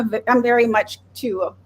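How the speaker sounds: ordinary talk, nothing marked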